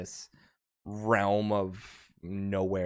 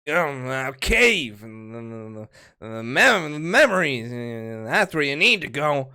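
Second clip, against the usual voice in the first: put-on drunk mumbling; 4 semitones higher, 9 dB louder than usual. Drunk patron rambling about the cave, halting and slurry. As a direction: stumble through it like a sot. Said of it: "he starts mumbling something".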